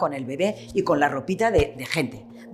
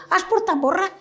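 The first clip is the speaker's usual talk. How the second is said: a neighbour's call, pitched up, in village speech; villager's question; 13 semitones higher than usual. Retold or imitated. Neighbour comes asking the mother for stuffing, pitched up.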